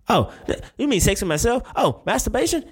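real surprise at the question; surprised realization. Real, not acted. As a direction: suddenly get it and squeak in disbelief.

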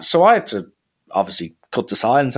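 usual speaking voice

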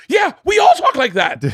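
high, loud, defensive yell; high shout. Retold or imitated. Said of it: "And he's like".

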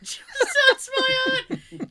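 high, slow mock echo. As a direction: repeat it high and slow, teasing. Echoing a phrase, mock dramatic.

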